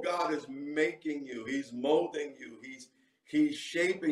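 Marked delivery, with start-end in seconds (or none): none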